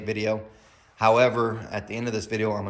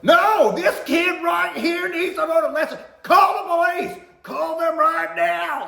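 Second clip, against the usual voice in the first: much higher, screaming angry mom; furious shout; 9 dB louder, slower than usual. Retold or imitated. Mom demanding the manager call police, furious shout. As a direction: yell it for the whole store. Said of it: "she is right in it".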